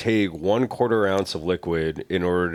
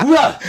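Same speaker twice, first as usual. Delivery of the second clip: startled yelp; 10 dB louder than usual. Retold or imitated. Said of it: "He's like".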